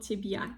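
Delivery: тебя broken into "tib-YA"; added Y sound, wrong. Shown as a demonstration. Teacher repeating the mistake, extra Y in тебя. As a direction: say тебя with a Y after the B. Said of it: "it's not correct".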